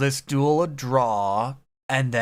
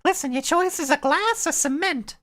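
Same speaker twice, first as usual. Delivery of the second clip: cackling witch. Acted. Fairy godmother offering Cinderella shoes, high cackling witch. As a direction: screech it out like a mad fairy witch. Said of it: "cackling witch".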